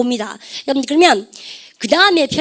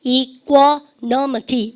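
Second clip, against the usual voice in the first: "이코노미티" very slowly, broken into syllables; "이코노미티" drawn out for learners to follow; syllable-by-syllable pronunciation; much slower than usual. Shown as a demonstration. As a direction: model "이코노미티" slowly, part by part. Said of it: "발음해보세요".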